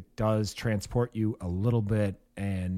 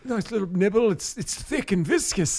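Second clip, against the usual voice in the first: much higher, hammed-up British accent; Jacob Elordi impression; 10 semitones higher than usual. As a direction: play a cheeky Brit, pitched way up. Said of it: "Jacob E. Lordy was like".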